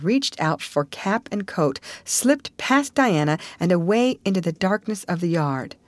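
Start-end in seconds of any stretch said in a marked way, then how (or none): none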